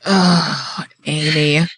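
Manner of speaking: long exasperated sigh into the name; sighed-out mock disapproval; mom sigh. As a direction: imitate a mother's weary, disappointed sigh. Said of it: "mom sighs that was just like".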